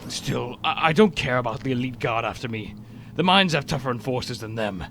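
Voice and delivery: normal talking voice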